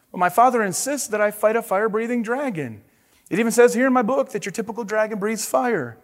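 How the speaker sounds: high-pitched, mild whine; moaning knight. Acted. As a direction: moan your complaint in the knight's voice. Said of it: "Sir George moaned".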